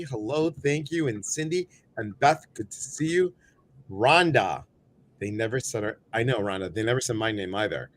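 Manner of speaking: ordinary delivery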